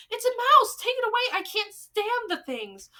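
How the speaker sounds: shrieking high and loud in terror; panicked yelling. Acted. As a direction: scream it away from the mouse. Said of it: "She sprang out of her chair yelling".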